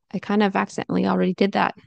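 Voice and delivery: ordinary talk, nothing marked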